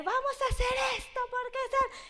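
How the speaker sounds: squeaky eager imitation of the boyfriend; high mock boyfriend voice. Teller mimicking her boyfriend's pitch, high and eager.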